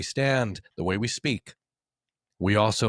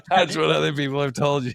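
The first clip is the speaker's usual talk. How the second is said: higher, louder, laughing through the words; laughing tease; 8 semitones higher, 4 dB louder than usual. Real, not acted. Host laughing as he teases his co-host, real laughter. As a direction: tease him while cracking up.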